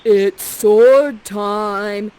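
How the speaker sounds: loud, drawn-out battle cry; eager young Ferdinand. Acted. Ferdinand answering Prospera's accusation, slow loud battle cry. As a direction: bellow it slowly like a hormonal boy. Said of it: "he's just like this".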